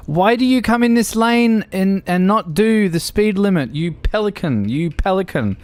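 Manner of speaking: loud, high angry Aussie driver; Aussie accent. Retold or imitated. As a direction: shout like a road-raging Australian. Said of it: "you with bad Aussie accents".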